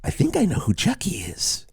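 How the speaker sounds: hushed conspiratorial whisper; whispering a suspicion to the co-host. Pretend. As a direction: whisper it like a secret.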